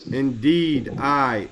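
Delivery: ordinary talk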